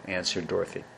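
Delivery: plain reading voice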